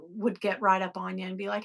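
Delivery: usual speaking voice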